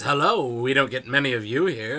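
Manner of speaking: gracious clergyman greeting a stranger; smiling priest. Acted. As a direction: repeat the priest's welcome with a lilt. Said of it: "Oh, he says".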